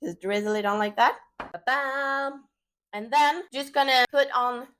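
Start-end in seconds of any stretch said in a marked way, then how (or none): none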